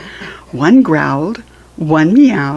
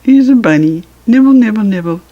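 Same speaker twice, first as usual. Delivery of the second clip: playful bunny; 4 semitones higher, 7 dB louder, faster than usual. Pretend. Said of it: "the bunny just nibbled".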